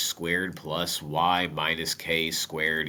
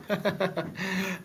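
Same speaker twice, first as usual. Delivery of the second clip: light laugh; 12 semitones higher, 6 dB quieter than usual. Real, not acted. Laughs at own joke.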